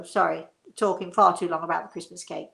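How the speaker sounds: normal talking voice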